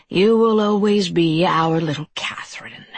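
breathy creature murmur; murmured taunt. A narrator's reading. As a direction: murmur it with mock fondness. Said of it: "he murmured".

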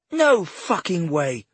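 high, loud, slow outburst; exploding in anger. Acted. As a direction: explode at him. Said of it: "she exploded".

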